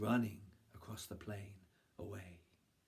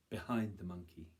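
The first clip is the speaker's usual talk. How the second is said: ordinary delivery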